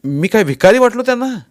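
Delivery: high, loud indignant question; angry outburst. A narrator's reading. Husband to wife, feeling treated as a beggar, angry outburst. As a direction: flare up in wounded pride. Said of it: "मी रागानं तडतडलोच".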